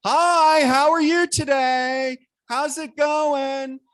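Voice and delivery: loud, high, drawn-out greeting; talking loud. Acted. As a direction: greet an elder as if hard of hearing. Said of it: "I would talk loud to them".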